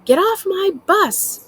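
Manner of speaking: angry shout. A narrator's reading. Bus driver yelling at an outcast to get off. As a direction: bellow him off the bus. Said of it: "erupts in an angry shout".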